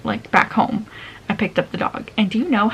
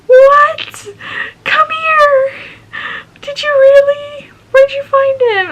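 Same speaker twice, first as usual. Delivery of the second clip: much higher and louder, squealing with delight; excited mom; 9 dB louder, slower than usual. Retold or imitated. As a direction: gush like you just saw a puppy. Mom sees the puppy.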